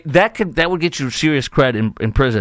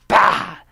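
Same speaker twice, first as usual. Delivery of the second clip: sudden high "bah" punch sound; punchy vocal sound effect; imitated punch noise; 8 semitones higher, 3 dB louder than usual. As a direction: burst out a mock punch impact.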